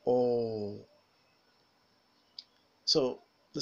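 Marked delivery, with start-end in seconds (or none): 0.0-0.8 "O" as a long, tense, rounded back aw vowel; model vowel sound. Shown as a demonstration. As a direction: hold "O" long with rounded lips, as in "fall". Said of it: "Now listen and repeat after me".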